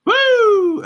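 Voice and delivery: high, loud "woo"; sharp falsetto whoop. Real, not acted. Streamer shaking off the voice work, loud high whoop. Whoop before announcing a break.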